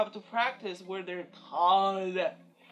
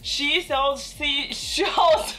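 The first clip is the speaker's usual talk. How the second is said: slow, deliberate syllables; slow careful attempt; 4 semitones higher, 6 dB louder, much slower than usual. Shown as a demonstration. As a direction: take it slowly, word by word. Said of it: "slow attempt".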